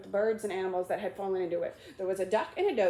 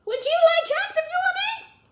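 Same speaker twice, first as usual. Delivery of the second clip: very high, loud squeak of protest; shrill squeaky mouse; 11 dB louder than usual. Acted. Mouse shrieking its protest at Alice, shrill and high. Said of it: "in a shrill ... passionate voice".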